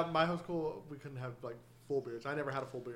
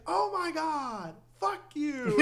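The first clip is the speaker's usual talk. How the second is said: put-on high, slow outraged kid yell; be an eight-year-old furious at her mom; angry little girl; 12 semitones higher, much slower than usual. Little girl tells her mom off, high outraged yell. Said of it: "she's like".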